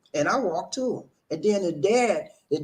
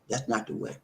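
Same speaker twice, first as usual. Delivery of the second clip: ordinary delivery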